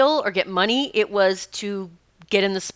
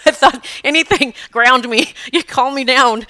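louder, cracking voice with a laugh; half laughing, half pleading to the crystals; emotional laughing plea; 5 semitones higher, 7 dB louder than usual. Retold or imitated. Clutching crystals mid-presentation, laughing and cracking. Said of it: "cracking up my voice".

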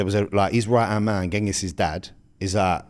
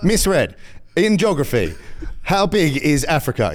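lightly voiced quiz host, a bit higher; game show host voice; 5 semitones higher than usual. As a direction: ask it like a cheesy quiz host. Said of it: "So he's like".

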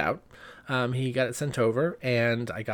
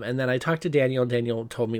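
usual speaking voice

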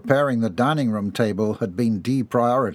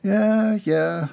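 higher, muttered yeah; muttering Orange; 9 semitones higher than usual. A narrator's reading. Orange at the counter, muttering higher. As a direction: grumble it under the breath, higher. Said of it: "Orange muttered".

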